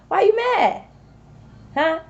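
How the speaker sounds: high mock-innocent jab; mocking taunt. Real, not acted. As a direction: tease them like a playground chant. Quoting the song title mockingly.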